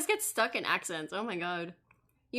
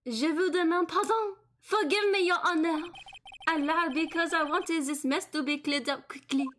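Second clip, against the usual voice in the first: put-on French accent; 7 semitones higher than usual. Chef apologizing to the judge, pleading French accent. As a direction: beg forgiveness in a thick French accent. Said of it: "I keep getting stuck into the French".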